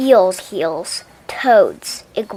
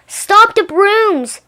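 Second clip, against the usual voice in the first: high, loud and slow shout; 10 semitones higher, 8 dB louder, much slower than usual. A narrator's reading. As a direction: bellow the order to stop. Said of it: "shouted the manager".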